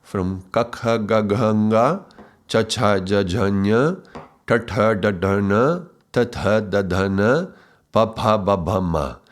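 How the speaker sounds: each consonant row said slowly and clearly, kakha gaghanga; reciting Sanskrit consonants. Shown as a demonstration. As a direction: spell out kakha gaghanga syllable by syllable. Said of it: "We can see".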